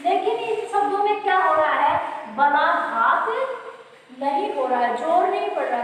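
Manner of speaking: usual speaking voice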